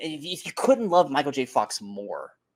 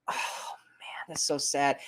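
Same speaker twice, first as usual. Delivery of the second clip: sad hushed reaction; 6 dB quieter, slower than usual. Retold or imitated. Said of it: "And you're just like".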